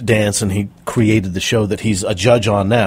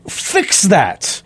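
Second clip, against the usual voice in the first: raised, emphatic mock command; retold urgent order; 6 semitones higher than usual. Producer orders the coach onstage, urgent retold command.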